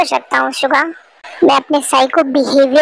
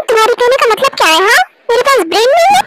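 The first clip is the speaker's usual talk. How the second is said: offended; 6 semitones higher, faster than usual. Acted. Wife takes offence at husband.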